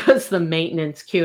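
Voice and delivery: ordinary talk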